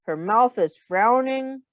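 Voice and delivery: plain reading voice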